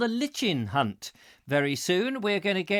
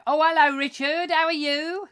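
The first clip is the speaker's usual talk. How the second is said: imitating caller; 11 semitones higher, 6 dB louder than usual. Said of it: "They go".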